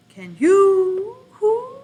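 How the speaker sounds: high, loud hooting; owl hoot. Shown as a demonstration. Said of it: "Who can read like an owl?".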